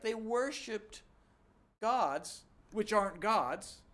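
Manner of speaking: put-on a hurt father scolding his kids; frustrated parent. The Lord complaining to Israel, indignant and slow. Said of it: "he's a frustrated parent".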